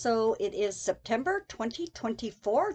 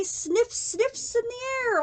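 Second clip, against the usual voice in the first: ordinary delivery, nothing marked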